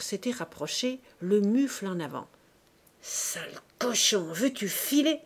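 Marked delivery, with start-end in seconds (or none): 3.0-5.2 husband choking with rage at the lover. A narrator's reading. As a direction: snarl the order to get out. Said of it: "Étouffant de rage".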